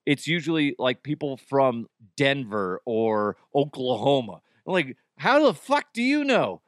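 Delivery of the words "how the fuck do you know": mock indignant. Retorting to imagined accent critics.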